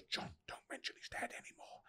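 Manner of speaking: very soft, voiceless whisper; whispered warning. Retold or imitated. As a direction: whisper it like a discreet plea, imitating someone. Producer's discreet warning, retold.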